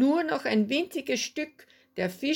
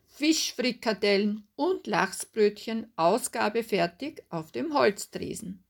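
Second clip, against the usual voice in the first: ordinary delivery, nothing marked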